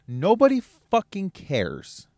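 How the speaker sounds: slow high-pitched hammer. Real, not acted. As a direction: stretch each word out, up high. Rant climax.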